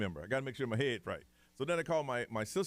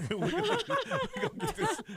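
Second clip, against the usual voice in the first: laughing; 4 dB louder than usual. Real, not acted. Laughing while reassuring niece.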